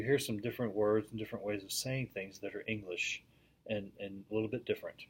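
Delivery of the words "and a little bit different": ordinary delivery, nothing marked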